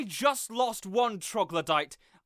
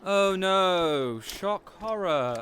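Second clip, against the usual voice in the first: mock alarm; 3 semitones lower, 4 dB louder, much slower than usual. Mocking a guard's objection.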